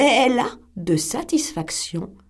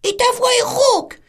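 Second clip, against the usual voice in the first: very high, fast little goat voices, shouting at the end; squeaky kids accusing the wolf; young goats refusing the wolf, very high and loud; 14 semitones higher, 5 dB louder than usual. A narrator's reading.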